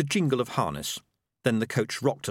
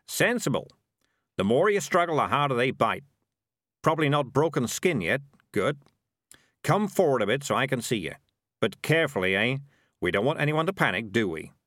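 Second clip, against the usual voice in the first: higher, lively instructing character voice; animated, wryly reassuring threat; Morris in control; 5 semitones higher, 3 dB louder than usual. Acted. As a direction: coolly talk a captive through it.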